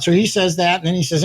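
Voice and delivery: ordinary talk, nothing marked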